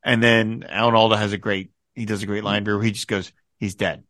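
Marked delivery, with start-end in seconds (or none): 3.6-4.0 plain, understated "He's dead"; matter of fact. Retold or imitated. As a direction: state it flatly as a simple fact. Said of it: "Just very matter of fact".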